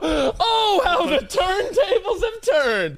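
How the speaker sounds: high, loud laughing gloat. Real, not acted. Host laughing at co-host being starstruck, gloating shout. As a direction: crow with laughter at the reversal.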